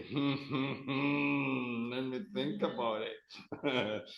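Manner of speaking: lightly voiced thinker, humming; acted pondering. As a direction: hum as if mulling it over, slowing at the end. Acts someone pondering the offer.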